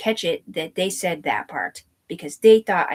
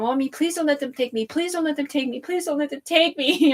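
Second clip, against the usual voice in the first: put-on a frightened child begging her mother, higher and higher; childlike pleading; 9 semitones higher than usual. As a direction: plead with mommy like a scared little kid. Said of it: "I'm like".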